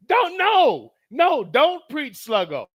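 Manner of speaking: high loud no, dragged out; high protest. Real, not acted. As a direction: plead with the chat to stop. Protesting chat comment.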